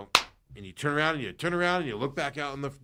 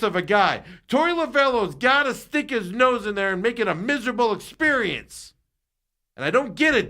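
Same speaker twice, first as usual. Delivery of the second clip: furious rant; 7 semitones higher, 4 dB louder than usual. Real, not acted. Host fuming about Torey.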